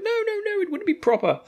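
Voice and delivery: very high, prissy protest; prim squeak. Retold or imitated. Rand refusing Selene's glory talk, high prim squeak. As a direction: squeak it out like a prudish boy. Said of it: "it's just like".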